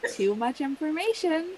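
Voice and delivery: high-pitched retold recoil; mock squeamish. Grandchild hearing grandpa read them too, squeamish.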